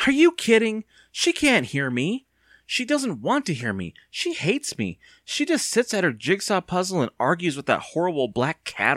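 high, agitated protest; exasperated. Acted. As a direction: reject the idea with indignation. Evan bitterly dismisses asking his aunt.

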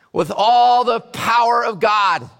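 ordinary talk, nothing marked

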